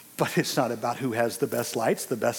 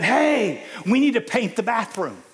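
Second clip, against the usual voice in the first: mock shout; 10 semitones higher, 7 dB louder than usual. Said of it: "texted me and said".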